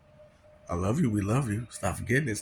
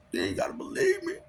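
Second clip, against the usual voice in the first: high shouted repeat; 3 dB quieter, faster than usual. Real, not acted. Daring listeners who doubt him, cracking high shout. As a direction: yell it out, voice breaking high.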